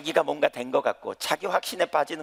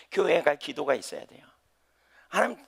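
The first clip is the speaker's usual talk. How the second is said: usual speaking voice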